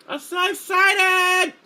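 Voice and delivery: very high, slow stretched shout of joy; mock excited. Streamer echoing a viewer's excited comment, hammed-up shout. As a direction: belt it out in giddy glee.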